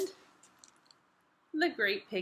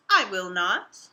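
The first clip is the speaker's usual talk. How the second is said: low, louder stubborn refusal; defiant refusal; 6 semitones lower, 6 dB louder, slower than usual. Acted. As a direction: dig in and refuse. Quarrel between Pig and Pug.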